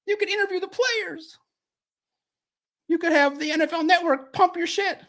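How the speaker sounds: high, coaxing mock wife voice; voicing his wife. A wife nudging him to join the Lions, high and coaxing. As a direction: nag him in a high teasing voice. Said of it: "my wife's probably in the background nudging".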